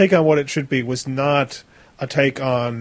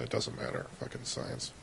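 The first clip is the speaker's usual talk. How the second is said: dismissive aside; 2 semitones lower, 20 dB quieter than usual. Real, not acted. Host abandons his magnetic field argument, dismissive aside. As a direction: drop it away almost to nothing.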